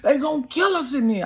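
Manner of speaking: high panicky worried coach; paranoid coach. Retold or imitated. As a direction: whine like a terrified coach. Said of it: "My coach is paranoid".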